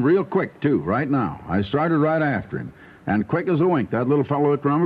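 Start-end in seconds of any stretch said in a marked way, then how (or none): none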